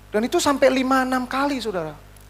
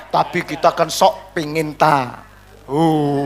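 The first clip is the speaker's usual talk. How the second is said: ordinary talk, nothing marked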